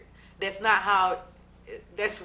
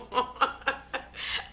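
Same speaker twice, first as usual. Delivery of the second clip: breathy laugh; 4 semitones higher, 5 dB quieter than usual. Real, not acted. Laughing at own joke.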